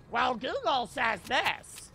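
slow, drawn-out mock know-it-all voice; mocking imitation. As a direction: parody a silly objector, stretching the words. Mocking people who cite Google.

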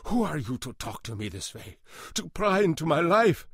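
put-on accented outrage climbing high and loud; indignant cry. A narrator's reading. Janitor confronting the man prying into his life, indignant cry. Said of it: "he cried".

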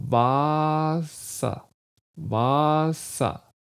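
WASSER, first vowel drawn out wide; long open A. Shown as a demonstration. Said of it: "ein schönes offenes A".

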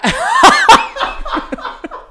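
loud high laugh. Real, not acted. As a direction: burst out laughing. Laughs at revenue joke.

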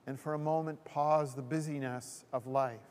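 usual speaking voice

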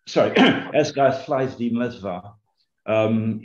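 ordinary delivery

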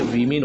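वीमेन with 'vi' first; correct plural pronunciation. Shown as a demonstration. Teacher models the plural of woman, वीमेन as VI-min. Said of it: "वुमेन नहीं होता है".

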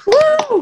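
high, loud "woo"; cheering whoop. Real, not acted. Host applauding the award winner, loud high cheer.

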